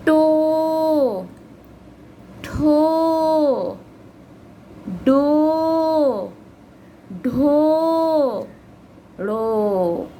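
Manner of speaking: टो, ठो, डो, ढो, ड़ो each drawn out like a chant; sing-song recitation of टो ठो डो ढो ड़ो; reading syllables aloud. Shown as a demonstration. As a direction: slowly model each syllable for the class. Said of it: "आगे बढ़ते हैं".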